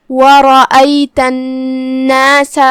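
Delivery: وَرَأَيْتَ النَّاسَ with the ن held as two letters; slow recitation of وَرَأَيْتَ النَّاسَ, humming through the doubled ن; doubled nun. Shown as a demonstration. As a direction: hold the doubled ن in النَّاسَ before the long aa. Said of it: "نون کو دو حرف کے برابر پڑھیں گے".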